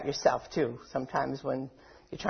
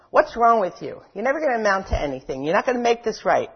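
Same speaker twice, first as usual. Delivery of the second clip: harsh inner critic; 4 semitones higher, faster than usual. Retold or imitated. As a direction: berate yourself like the critic. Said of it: "Can you hear it?".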